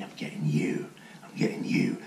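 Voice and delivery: imitated creepy whispered threat; menacing whisper. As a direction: whisper it like a villain on a screen. Mimics menacing figures on the screen.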